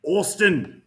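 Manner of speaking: lightly voiced Fat Bastard impression. Friend suggested Fat Bastard, a light Fat Bastard impression. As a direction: growl the name like Fat Bastard. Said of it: "try Fat Bastard".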